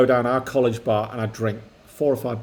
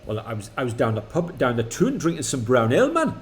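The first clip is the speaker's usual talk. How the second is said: put-on a light, amateur Geordie accent; a son asks his dad for a Geordie accent, mock Geordie lad. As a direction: put on a hammed-up Geordie lad's voice. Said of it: "you'd do your Geordie accent".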